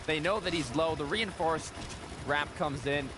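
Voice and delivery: normal talking voice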